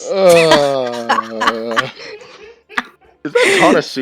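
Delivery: laughing high and loud. Real, not acted. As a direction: squeal it through laughter. GM laughs at reference.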